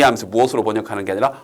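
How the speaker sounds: ordinary delivery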